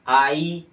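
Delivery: very loud, stretched "आई"; vowel from throat. Shown as a demonstration. Teacher shows where sound starts, "आई" loud and drawn out. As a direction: belt out a long "आई" from the throat. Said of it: "यहाँ से".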